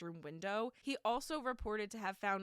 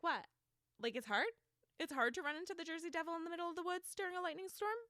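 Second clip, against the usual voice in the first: mock incredulous; 8 semitones higher, much faster than usual. Mocking witness's incredulous quote.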